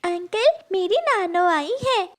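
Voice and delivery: put-on high childlike pleading voice; little girl voice. (ऊँची आवाज़ में छोटी बच्ची)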